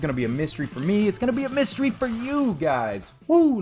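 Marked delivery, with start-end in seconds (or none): 1.1-3.1 high-pitched, sing-out hype for the viewers; excited, high teasing lift; playful excitement. Real, not acted. Hyping the mystery to viewers. 3.3-3.6 high, loud "woo"; loud celebratory woo; excited whoop. Real, not acted. Whoops at the mystery reveal.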